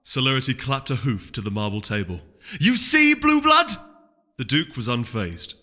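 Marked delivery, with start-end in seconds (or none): 2.6-3.7 much higher and louder, taunting; gloating. Acted. Duchess gloating at the duke, much higher and louder. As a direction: rub it in his face. Gloating at rival after news.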